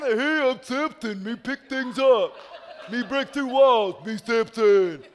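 slow, loud dumb caveman; thick-headed brute talking slowly. Retold or imitated. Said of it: "What was Sam?".

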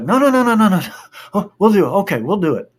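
high, rapid-fire pleading; mock-alarmed appeasement; flustered backing down. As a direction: imitate kids hurriedly giving in. Retold kids hastily backing down.

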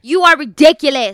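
yelling into mic. Real, not acted. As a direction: yell it deliberately straight into the mic. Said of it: "You don't have to yell into the mic".

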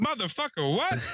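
put-on high, outraged old-man holler; mad as hell. Old man hearing his go-kart was taken, mad as hell. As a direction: imitate incredulous fury. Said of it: "Like, mad as hell".

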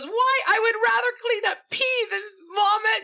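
very high mock wail of outrage; grandmother losing it. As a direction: imitate an exasperated granny shrieking her complaint. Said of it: "My grandmother lost it".

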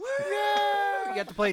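very high, slow shout; high shouted joke. Acted. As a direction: yell the punchline high. Joking in the shouted bit.

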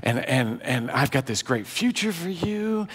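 soft, hushed fatherly declaration of love; tender reassurance. Acted. God speaking tenderly to a believer.

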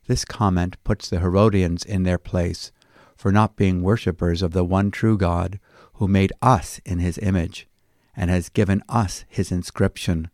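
ordinary delivery

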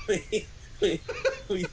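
high and broken by giggles; laughing. Real, not acted. Reader can't continue the line for laughing. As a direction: stammer through laughter.

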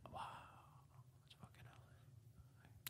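barely audible whispered wonder; hushed awe. Retold or imitated. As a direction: retell it as an awestruck whisper. Retelling awed arrival in LA.

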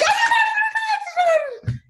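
very high mock squealing babble; shrill-pitched excited gibberish cheer; retold cheering. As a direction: imitate children shrieking with delight. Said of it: "they're like".

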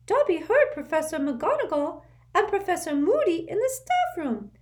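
urgent little servant squeaking; an anxious house elf waking his master; squeaky house elf. A narrator's reading. Dobby voiced.

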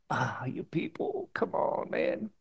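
slow, sighing, half-breathed exasperation; big sigh. Retold or imitated. Jesus sighing at the people, slow and weary. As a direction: let it out as one long weary sigh. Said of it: "So this big sigh".

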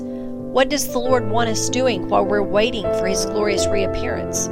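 normal talking voice

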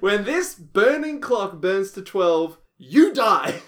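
slow, high dramatic announcer voice; booming trailer narrator. Pretend. Dramatic premise read out.

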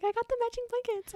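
high chirpy voice, lightly put on; a hint of a giddy Elaine; mock sweet chirp. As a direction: chirp it like a proud little girl. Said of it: "She's like".

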